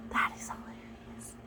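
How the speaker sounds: breathy whispered aside. Real, not acted. Telling viewers the joke, hushed whisper. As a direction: whisper it like a secret.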